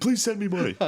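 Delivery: hammed-up helpless plea; pleading son. Grown son begging mom for money, mock-pleading.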